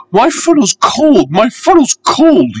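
very high chanted puffing complaint; puffing little engine. A narrator's reading. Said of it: "he would puff".

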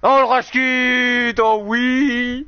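drawn-out, high, loud cheer; excited. Real, not acted. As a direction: cheer the goal, stretching it. Celebrating a goal.

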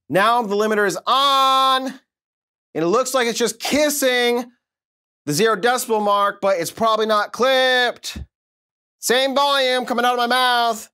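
high, slow, stretched comic voice; Chris Tucker impression. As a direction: squeal it out high and drawn. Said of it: "It's my Chris Tucker impression".

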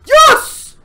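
victorious loud yes; triumphant yell. Real, not acted. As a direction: cheer the win. Found last fragment.

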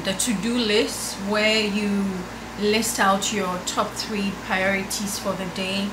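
ordinary talk

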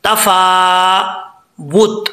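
तफावुत drawn out slowly, the वाव said with rounded lips; slow recitation. Shown as a demonstration. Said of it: "होंठ गोल करके नहीं पढ़ना". (धीमी तिलावत में तफावुत, वाव पर होंठ गोल करके)

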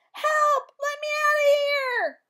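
high, loud cry for help; terrible scream. A narrator's reading. Talking parrot startles the class, high loud scream. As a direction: scream it out as if trapped. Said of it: "there was a terrible scream".

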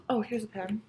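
whispered aside to herself; whispering. Real, not acted. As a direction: drop to a whisper. Aside while grabbing a pen.

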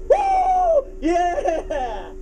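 high whoop through laughter; laughing cheer. Retold or imitated. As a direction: celebrate a touchdown, laughing. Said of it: "I get excited about the stupid Bears".

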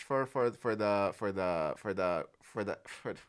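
tripping over repeated words; stammering on for the; stuttering. Real, not acted. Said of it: "I'm stuttering".

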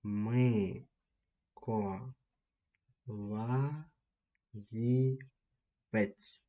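Миколаївець said very slowly, syllable by syllable; slow teacher's model word; drawn-out demo word. Shown as a demonstration. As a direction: stretch out Миколаївець slowly for the class. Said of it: "А він хто?".

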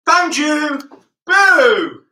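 loud high found you and boo; playful shout. Pretend. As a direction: pounce on the kids with a shout. Seeker catches kids.

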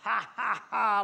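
hearty mock laugh. A narrator's reading. First pig laughing at his hard-working brother, mock laugh. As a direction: laugh it out as a carefree little pig. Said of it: "laughed the first little pig".

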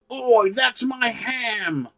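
high, slow, put-on British lad voice; mock British lad. Orc guarding his food, hammed-up British lad complaint. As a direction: drag it out like an indignant British pub lad, British accent. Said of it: "the orcs in Games Workshop all talk like, like weirdo British guys".

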